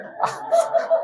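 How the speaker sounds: short breathy high laugh at himself; a quick airy chuckle; quick unvoiced laugh. Real, not acted. Laughs at own story.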